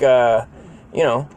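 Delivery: normal talking voice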